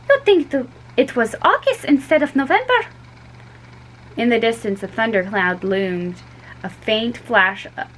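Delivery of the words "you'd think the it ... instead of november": Fiona's voice. A narrator's reading. Fiona complains about weather.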